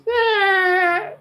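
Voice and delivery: high, whiny, hesitant mock reply; timid mumble imitation. Barista dodging being a Kings fan, mocked timidly. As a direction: mimic a scared guy dodging the question. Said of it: "so he goes".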